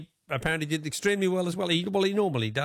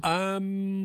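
ordinary delivery

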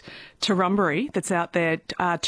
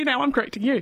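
normal talking voice

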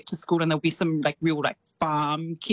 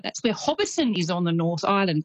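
ordinary talk

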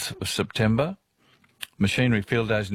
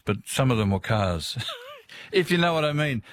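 ordinary talk, nothing marked

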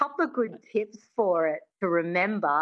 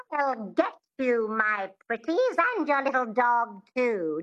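Wicked Witch; 4 semitones higher than usual. Shown as a demonstration. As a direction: draw it out with a witchy twang. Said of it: "Think of the Wicked Witch".

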